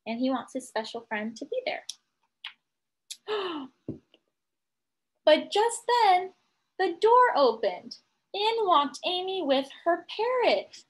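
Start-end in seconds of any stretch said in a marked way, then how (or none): 3.1-3.7 soft sharp gasp; surprised gasp. Acted. Teacher gasps as the door opens, surprised. 5.2-10.9 higher, slow, building reveal; excited reveal. A narrator's reading. Reading to children as Amy arrives, excited and slow. Dramatic reveal in read story.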